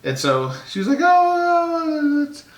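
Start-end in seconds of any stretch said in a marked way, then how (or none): 1.0-2.4 high, louder imitation of the touched wife; husband mimicking his touched wife. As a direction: coo it high like the delighted wife.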